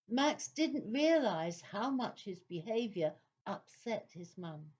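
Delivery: ordinary delivery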